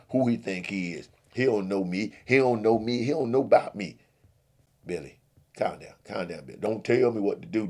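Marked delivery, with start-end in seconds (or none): 1.3-4.0 louder, rising menace; building threat. Acted. Billy threatening the deacon. 4.8-6.6 soothing. Acted. Friend soothing Billy.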